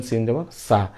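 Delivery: light s in সা with normal lips; sin, unrounded. Shown as a demonstration. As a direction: keep the mouth unrounded on সা. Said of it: "সিন উচ্চারণ করতে মুখ গোল হবে না". (মুখ গোল না করে সা)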